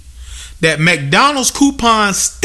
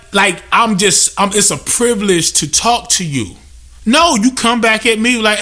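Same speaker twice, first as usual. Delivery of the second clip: ordinary delivery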